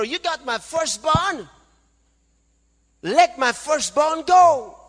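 slow, high, booming voice of God ordering Pharaoh; God demanding Pharaoh free his people, loud and slow; God's command. Acted. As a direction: let my firstborn go, thundered from on high.

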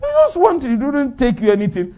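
ordinary talk, nothing marked

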